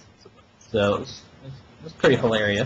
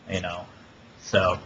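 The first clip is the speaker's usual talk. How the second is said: ordinary talk, nothing marked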